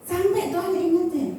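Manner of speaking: ordinary delivery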